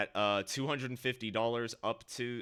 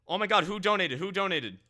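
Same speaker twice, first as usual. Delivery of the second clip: high, loud, eager question; excited; 8 semitones higher, 8 dB louder, faster than usual. Real, not acted. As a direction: burst out wanting to know who. Asking who gave the donation.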